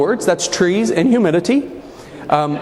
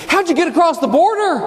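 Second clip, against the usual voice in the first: high-pitched mock-earnest question; sincere, inquisitive; 10 semitones higher than usual. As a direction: imitate a sincerely puzzled driver, pitched well up. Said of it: "in the most sincere, inquisitive voice".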